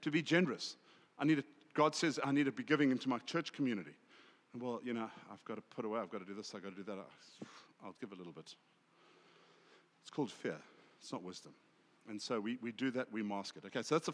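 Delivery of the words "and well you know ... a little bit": low, soft grumble, fading away; reluctant excuse-making. Acted. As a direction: talk yourself down quietly into giving less. Acts out reluctant excuse-making about giving.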